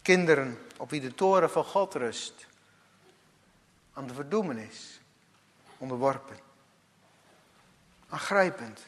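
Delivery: normal talking voice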